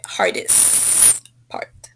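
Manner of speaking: slow "hardest" with a long final st; demonstrated correction. Shown as a demonstration. Teacher tells a student what to say, drawn-out "hardest". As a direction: hold the ending of "hardest", then "part". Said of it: "you should say".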